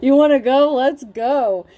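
hammed-up fighting talk; mock fight call. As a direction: square up, daring them.

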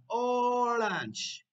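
"Orange" high and stretched, falling at the end; model for repeating. Shown as a demonstration. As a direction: hold "Orange" long for learners. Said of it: "once again, una vez más".

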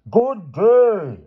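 high, loud dismissive bellow; furious shouted send-off; thundering. A narrator's reading. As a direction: roar the dismissal, stretching it out. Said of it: "thundered Mister Sturgeon".

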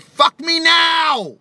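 high, slow shouted "Fuck me now!"; screamed demand. Retold or imitated. A Bulgarian girl yells at him, a slow high shout. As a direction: belt it out like a frantic lover. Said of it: "She said".

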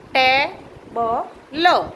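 Table broken into slow, separated sounds "te - ba - lo"; syllable by syllable. Shown as a demonstration. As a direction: sound out table very slowly for someone writing it. Teacher sounds out word for writing.

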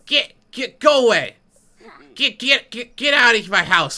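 high, stuttered shooing shouts; mock angry yell. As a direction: yell them off your property in pretend outrage. Yelling at kid in game.

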